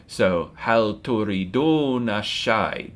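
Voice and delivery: hal turiduna ashai slow, each word apart; slow Arabic question. Shown as a demonstration. Teacher modelling offering tea, slow careful Arabic. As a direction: ask it slowly like a model sentence. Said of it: "Do y'all want tea?".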